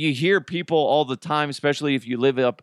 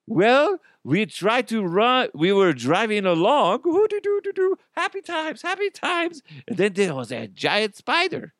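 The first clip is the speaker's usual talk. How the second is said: attempted Swiss accent, pitched much higher; a clumsy stab at a Swiss tourist's accent; singsong mock Swiss accent, humming happily; bad Swiss accent; 9 semitones higher than usual. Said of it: "I don't know how to do a Swiss accent".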